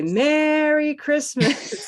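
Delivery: big drawn-out Santa greeting, high and slow. Shown as a demonstration. Teacher showing how someone dressed as Santa says it, hammed-up jolly Santa. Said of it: "maybe if they're dressed as Santa".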